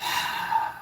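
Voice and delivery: long, soft exhale; resigned sigh; heavy sigh. Real, not acted. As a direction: let out a weary breath. Sigh after the rant.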